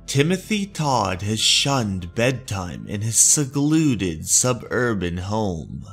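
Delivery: plain reading voice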